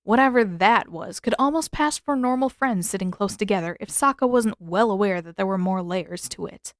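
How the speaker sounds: plain reading voice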